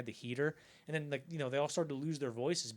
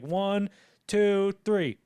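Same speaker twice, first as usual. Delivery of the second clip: counting down; 7 semitones higher, 9 dB louder, much slower than usual. Retold or imitated. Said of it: "they'd probably count down".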